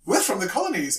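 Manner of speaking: put-on very broad South African accent. Couple in the lift greeting the wife, South African accent. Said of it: "very, very South African accent".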